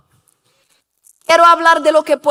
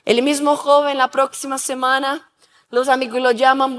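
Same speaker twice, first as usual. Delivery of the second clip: usual speaking voice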